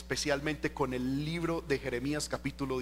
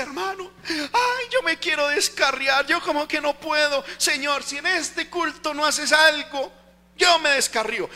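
mock whiny plea; 9 semitones higher, 7 dB louder than usual. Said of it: "hay gente que dice".

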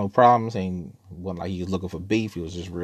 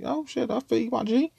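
mock sympathetic. Sympathising with cellmate about his girl.

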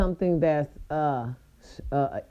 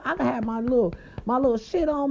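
ordinary talk

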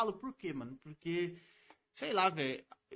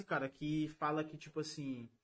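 losing the thread, slower and fading; trailing off; 5 semitones lower, 5 dB quieter, slower than usual. Real, not acted. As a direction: voice drops away mid-thought. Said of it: "passou já".

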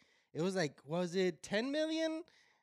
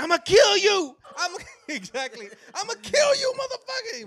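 high, loud hammed-up threat; angry threat; 12 dB louder than usual. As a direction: yell the threat through laughter. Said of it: "[name] is like".

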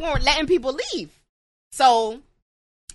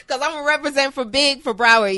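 ordinary delivery

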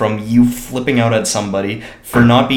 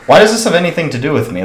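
higher, louder and fast, fed up; exasperated outburst; 6 semitones higher, 6 dB louder, faster than usual. Real, not acted. Venting to a friend about the parking fight, exasperated and fast. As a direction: burst out in disbelief that it's your problem.

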